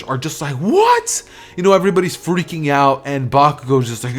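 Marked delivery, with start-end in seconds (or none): 0.6-1.2 very high, rising squawk of shock; hammed-up astonishment, pitch shooting up; shocked disbelief. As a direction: shriek it as a stunned question. Class stunned by the numbers.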